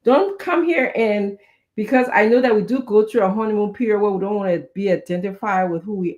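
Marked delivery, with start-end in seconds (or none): none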